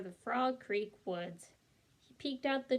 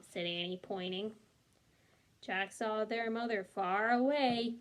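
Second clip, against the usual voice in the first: ordinary delivery, nothing marked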